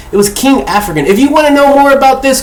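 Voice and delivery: normal talking voice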